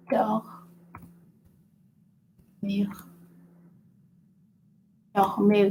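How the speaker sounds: "Dormir" with guttural French R. Shown as a demonstration. Teacher showing a word with two R's, "Dormir" with guttural R. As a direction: bring out the throaty R in "Dormir". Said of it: "R at the beginning and R at the end".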